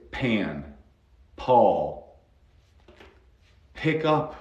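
"pan", "Paul", "Pick up" with puffed p; English aspirated P. Shown as a demonstration. Teacher gives English examples word by word, puffed initial p. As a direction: release each p of "pan", "Paul", "Pick up" with air. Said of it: "En inglés".

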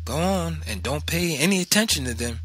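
high, brisk motherly urging; lightly acted mother brushing off worries; insistent mother. A narrator's reading. Mother pushing son out the door, high insistent urging. Mother urges boy onward.

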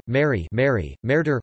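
usual speaking voice

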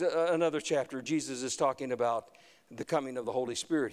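ordinary talk

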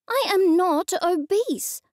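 high indignant snap; sharp snap. Acted. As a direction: snap back, offended. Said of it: "I snapped".

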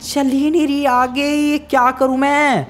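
high, desperate wail; helpless panic. Acted. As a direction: cry out helplessly in a high pitch. Car still stuck, helpless.

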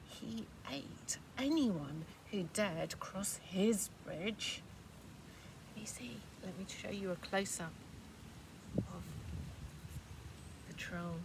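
hushed suspense whisper. Real, not acted. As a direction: whisper to build spooky tension. Storyteller builds suspense.

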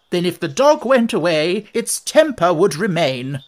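loud, triumphant exclamation; triumphant exclaim. A narrator's reading. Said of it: "the Queen exclaimed triumphantly".

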